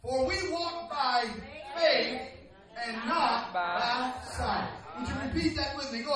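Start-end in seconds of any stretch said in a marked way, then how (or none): 0.0-4.7 slow, measured phrases with weighty pauses; scripture reading. Real, not acted. Preacher reading King James verse to congregation, slow and deliberate. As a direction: read the verse out slowly, phrase by phrase. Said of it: "I'm reading from the King James Version".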